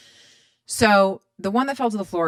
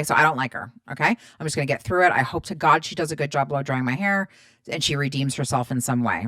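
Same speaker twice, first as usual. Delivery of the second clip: ordinary delivery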